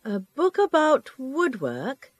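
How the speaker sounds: "A book about woodwork?" echoed back with a steady rise to the end; rising question intonation. Acted. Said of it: "Notice how the librarian's voice rises".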